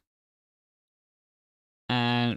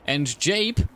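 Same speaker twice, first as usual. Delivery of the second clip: race commentary; 2 semitones higher than usual. Acted. Live race play-by-play.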